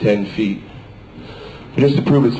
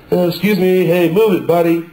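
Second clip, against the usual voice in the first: raised, impatient shove-past voice; pushy commuter; 8 semitones higher, 3 dB louder than usual. Pretend. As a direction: tell him to move, louder.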